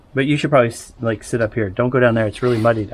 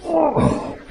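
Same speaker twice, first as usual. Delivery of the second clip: surprised; 13 semitones higher than usual. Real, not acted. Squeezing under the house.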